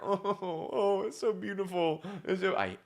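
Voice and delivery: a lightly hammed-up fake weep; fake crier. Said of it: "best fake crier".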